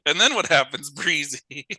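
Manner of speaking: ordinary talk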